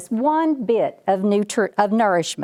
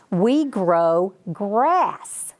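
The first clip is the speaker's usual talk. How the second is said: put-on slow suspenseful reveal for the kids; slow dramatic reveal; much slower than usual. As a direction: draw it out slowly, word by word. Big reveal to the children.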